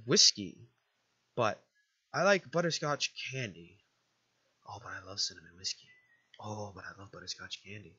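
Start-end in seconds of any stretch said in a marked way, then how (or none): 4.7-7.9 soft, low aside to self; hushed, indulgent longing; torn musing. Pretend. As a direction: agonize quietly between two loves. Agonizing aside over two choices.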